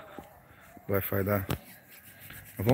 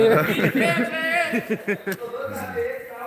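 high laugh; 13 semitones higher than usual. Real, not acted. Laughing at the joke.

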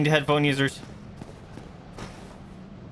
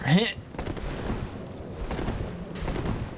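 breathy giggle; 6 semitones higher, 7 dB quieter than usual. Real, not acted. Laugh at climbing.

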